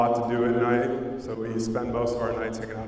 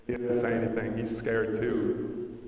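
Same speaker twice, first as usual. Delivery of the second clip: usual speaking voice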